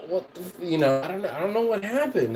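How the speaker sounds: high, fast, bewildered protest; high panicky innocent rush; flustered denial. Retold or imitated. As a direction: sputter it like you're caught out. Caught out, denying bewilderedly.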